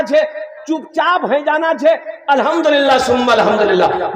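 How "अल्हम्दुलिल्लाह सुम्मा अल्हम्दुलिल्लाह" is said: ordinary talk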